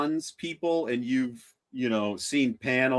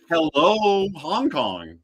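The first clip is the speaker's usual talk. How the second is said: ordinary talk, nothing marked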